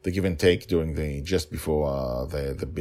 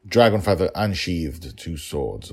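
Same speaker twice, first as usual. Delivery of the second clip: usual speaking voice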